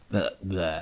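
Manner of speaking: low, soft monster garble; Cronenberg monster. Pretend. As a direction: mumble like a mutating monster. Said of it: "Du klingst ja schon wie ein Cronenberg".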